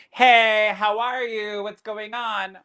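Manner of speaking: the loud obnoxious spirit drowning out the calm one. Shown as a demonstration. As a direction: barge in like an obnoxious shouter. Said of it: "comes in and going".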